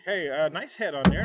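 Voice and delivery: ordinary talk, nothing marked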